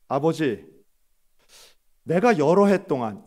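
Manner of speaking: loud, harsh protest to his father; the elder son finally yelling his grievance; angry outburst. Acted. Elder son shouting at his father in anger. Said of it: "큰 목소리로 거칠게".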